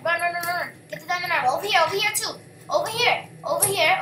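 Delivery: ordinary talk, nothing marked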